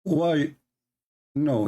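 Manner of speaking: usual speaking voice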